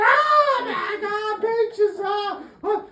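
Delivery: very high, drawn-out shrieking wail; mock frantic wife. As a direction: shriek in hammed-up hysterics. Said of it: "she's even more frantic".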